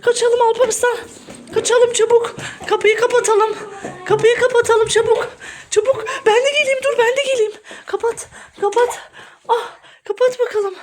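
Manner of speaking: high, frantic mock panic; spaventato per gioco. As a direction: play scared and urge an escape. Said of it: "çok korktum".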